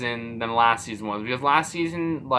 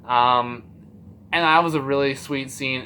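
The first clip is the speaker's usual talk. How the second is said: ordinary talk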